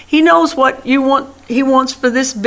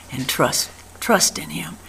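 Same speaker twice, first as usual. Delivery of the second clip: breathy whispered urging, much softer; hushed whisper; 6 semitones lower, 9 dB quieter than usual. Real, not acted. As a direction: drop to a whisper to press the point home. Preacher drops to hushed plea.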